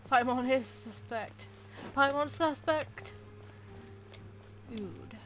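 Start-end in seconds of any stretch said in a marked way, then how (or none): none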